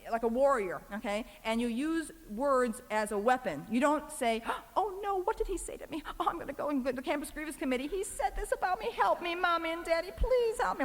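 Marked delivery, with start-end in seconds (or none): 4.8-10.8 high, pleading mock-helpless whine; mock whiny victim. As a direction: play the helpless student begging her parents. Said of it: "like that".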